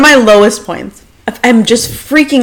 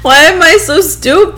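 high, hammed-up self-pity; playfully overdone crying voice; mock wail; 9 semitones higher than usual. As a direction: wail it in imitation of your drunk self. Joins retold crying phone call.

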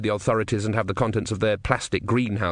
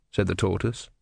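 ordinary delivery, nothing marked

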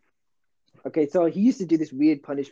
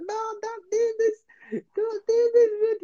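high, slow, tearful begging; retold crying plea; slower than usual. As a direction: plead high and drawn-out like a scared crying kid. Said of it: "I'm there crying".

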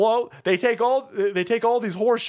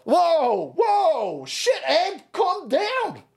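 high startled whoa; 9 semitones higher, much slower than usual. Real, not acted. Host startled by clip, high exclamations. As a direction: react like something shocked you.

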